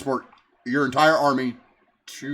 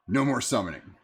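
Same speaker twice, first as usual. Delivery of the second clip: ordinary talk, nothing marked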